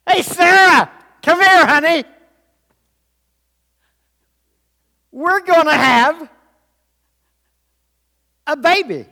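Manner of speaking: high, loud holler to a wife; calling out. Acted. Abraham calling Sarah with baby news, high excited holler. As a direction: shout the happy news across the house.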